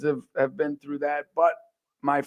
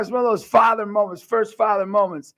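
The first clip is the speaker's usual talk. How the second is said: ordinary talk, nothing marked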